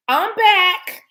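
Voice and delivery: high, loud announcement of return. Real, not acted. Announcing to viewers she's back, high and loud. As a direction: sing out that you're back.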